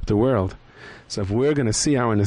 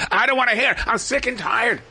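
annoyed refusal; 12 semitones higher than usual. Acted. Selfish character refusing.

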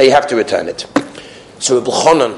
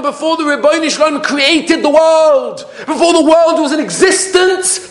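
high, driving sermon delivery; impassioned preaching; 3 dB louder than usual. Real, not acted. Rabbi proclaiming that repentance preceded creation, impassioned preaching. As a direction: proclaim it to the congregation.

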